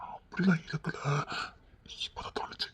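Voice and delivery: breathy secret whisper. Acted. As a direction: whisper so no one overhears. Secret whispers away from family.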